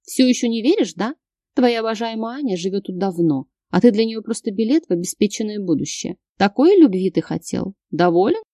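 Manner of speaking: ordinary delivery, nothing marked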